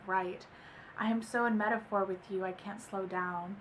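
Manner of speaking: soft, slowing love-poem reading. Acted. Reading a love poem to viewers, soft and slowing. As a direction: read it tenderly, easing down at the end.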